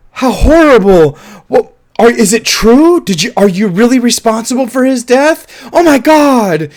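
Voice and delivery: high, loud gasping outrage; mock shocked lionesses. Lionesses gasping at Simba news.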